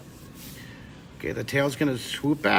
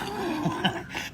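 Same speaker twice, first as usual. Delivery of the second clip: a breathy burst of laughter; high breathy laugh; 6 dB quieter than usual. Real, not acted. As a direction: giggle up high with no voice. Laughs at her own joke.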